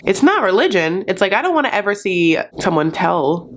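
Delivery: usual speaking voice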